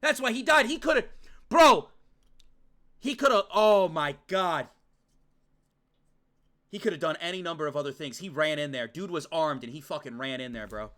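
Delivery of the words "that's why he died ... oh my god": high, loud, rushing disbelief; distraught outburst. Real, not acted. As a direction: protest the death, voice raised and upset. Distraught reaction to character's death.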